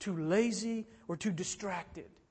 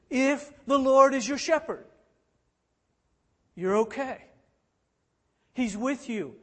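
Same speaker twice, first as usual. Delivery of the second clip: louder, higher, driving sermon climax; preaching at full force; 6 semitones higher, 10 dB louder than usual. Real, not acted. Pastor urging the congregation, preaching at full force. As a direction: build it up and hammer the promise home.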